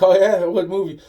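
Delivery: high, loud mocking "oh yeah?"; mock surprise. Mocking a guy's claim.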